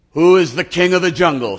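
a proud king daring the tiger; loud lordly lion challenge; lion demanding. Acted. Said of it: "says the lion".

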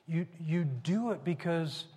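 usual speaking voice

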